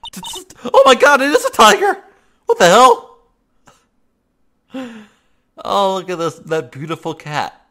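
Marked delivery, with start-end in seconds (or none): 0.1-2.0 high, loud stammered panic; stammer, then scream it out in fright; panicked shout. Acted. Sees the tiger, panics. 2.4-3.2 high, loud disbelief; shocked shout. Acted. Tiger attacks.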